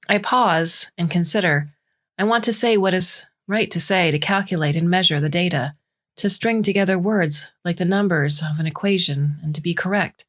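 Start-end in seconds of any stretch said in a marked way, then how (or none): none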